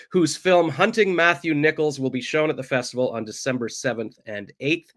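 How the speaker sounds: ordinary talk, nothing marked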